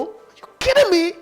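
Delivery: ordinary delivery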